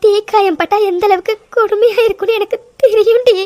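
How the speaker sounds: tearful, remembering the pain of burns; crying. Acted. Mithu weeps telling Vaishu about burn pain. Mithu afraid for her husband.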